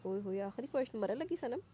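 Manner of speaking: usual speaking voice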